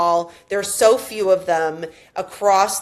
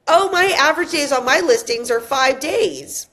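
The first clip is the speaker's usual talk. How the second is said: higher, showy sales-pitch boast; mock bragging agent; 6 semitones higher than usual. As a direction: imitate a smug agent showing off. Mocking agent's listing presentation boast.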